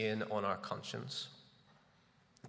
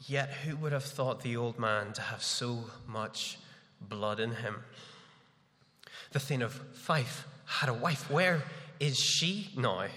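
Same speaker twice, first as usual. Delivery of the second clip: Lady Macbeth monologue. Acted. Preacher performing Lady Macbeth for the congregation, theatrical guilty monologue. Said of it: "I'll try my best to perform them".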